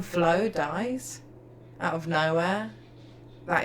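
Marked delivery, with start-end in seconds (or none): none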